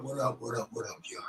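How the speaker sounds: trailing off into a whisper; fading to whisper. Real, not acted. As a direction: let it fade to a whisper. Repeated greeting fades out.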